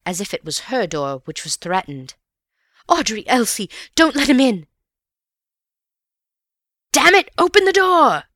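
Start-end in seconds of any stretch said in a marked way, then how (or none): 2.9-4.7 high, loud plea for help; urgent frightened cry, rising louder; panicked cry. A narrator's reading. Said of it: "cried Missus Stevens in a panic". 6.9-8.2 furious high-pitched yell; much louder, higher cursing demand; angry shout. A narrator's reading. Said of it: "came the voice again".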